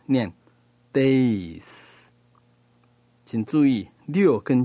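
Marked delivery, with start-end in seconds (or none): 0.9-2.1 "days" with an extra i glide before the final s; added i sound. Shown as a demonstration. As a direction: say "days" with a drawn-out ei diphthong. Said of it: "我们口头上多念一个一的音".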